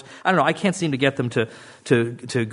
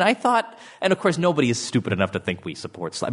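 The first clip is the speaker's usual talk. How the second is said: normal talking voice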